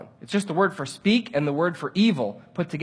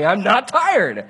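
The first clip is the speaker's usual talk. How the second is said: louder, emphatic denial; angry protest; 3 semitones higher, 5 dB louder, slower than usual. Retold or imitated. Driver objecting to a false claim about him, angry protest. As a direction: punch out each word slowly in indignation. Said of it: "I am so angry about it".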